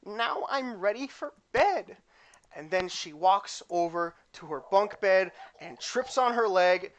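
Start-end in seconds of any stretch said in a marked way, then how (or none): 0.1-2.0 high, slow, content; girly voice. Acted. Clumsy girl getting ready for bed, slow high voice. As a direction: say it high and unhurried. Character voice again.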